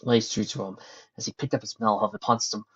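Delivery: usual speaking voice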